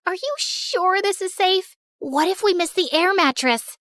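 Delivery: high-pitched nervous questioning; worried friend. Acted. Friend doubts the jump is safe, worried.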